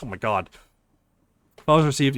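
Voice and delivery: usual speaking voice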